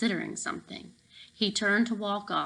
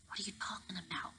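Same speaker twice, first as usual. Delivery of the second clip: softer, hushed question; whisper; 17 dB quieter, much faster than usual. Acted. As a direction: keep it secret from the guard. Secret talk with guard.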